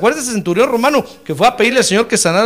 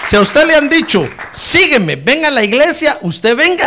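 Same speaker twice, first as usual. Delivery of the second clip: louder, higher exhortation; raised preaching voice urging them on; fervent preaching; 6 semitones higher, 5 dB louder than usual. Real, not acted. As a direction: press the congregation to come. Preaching at full force.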